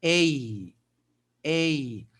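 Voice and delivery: ey, the letter A as English says it; ey, the English alphabet A. Shown as a demonstration. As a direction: say the a as a gliding ey. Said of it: "el sonido de la A en el abecedario inglés".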